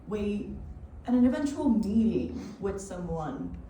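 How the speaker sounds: deliberate, dramatic monologue with weighted pauses; spoken-word performance. Acted. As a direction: perform it like a solo stage piece.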